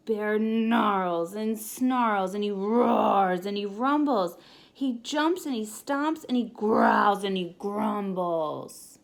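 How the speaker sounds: loud grumbly storyteller voice; growly narration. A narrator's reading. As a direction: thunder through it like an angry bear waking. Bear wakes up growling.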